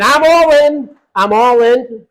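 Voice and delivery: high, loud and slowed, all punched; fired-up declaration. Real, not acted. Congressman rallying supporters for Trump, fired-up shout. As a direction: declare total commitment, rising to a shout.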